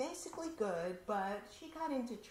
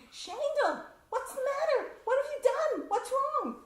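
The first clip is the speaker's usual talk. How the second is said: much higher, louder worried father's cry; alarmed father; 13 dB louder than usual. Retold or imitated. Father sees daughter home on a school day, alarmed much higher shout. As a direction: call out to your daughter, alarmed.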